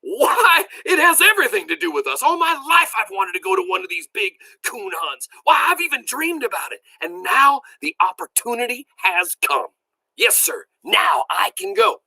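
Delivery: high, excited outburst, almost shouting; near shout. A narrator's reading. Grandpa eager to go on the big coon hunt, near shout. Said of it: "almost shouted".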